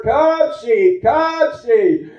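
high call to the flock; shepherd boy's holler. Retold or imitated. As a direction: call the sheep home. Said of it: "when that little boy hollered".

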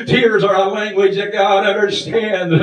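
fervent sermon delivery building on each line; preaching. Acted. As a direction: exhort the congregation with rhythm. Preacher exhorting congregation.

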